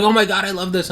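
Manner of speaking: hammed-up fan delight; gushing fan. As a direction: replay your teenage excitement, lightly hammed. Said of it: "I was like".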